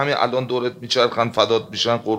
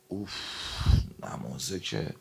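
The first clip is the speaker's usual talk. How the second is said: mock weary reluctance; 2 semitones lower, 10 dB quieter than usual. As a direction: act burdened by having to pray. Acting reluctance to get up for prayer.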